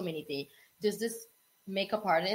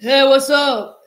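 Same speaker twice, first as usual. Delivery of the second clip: loud, slow mock deep voice; 6 semitones higher, 16 dB louder, slower than usual. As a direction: boom it out like a faked deep man's voice. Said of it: "the deeper voice".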